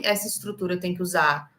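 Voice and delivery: ordinary talk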